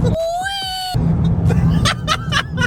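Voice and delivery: loud laugh. Real, not acted. Laughing at a car problem.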